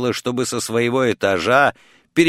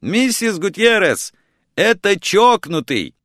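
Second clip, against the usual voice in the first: high-pitched, half-restrained yell; narrated yell, pitched well up; 8 semitones higher than usual. A narrator's reading. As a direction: call out urgently down the phone. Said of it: "заорал я".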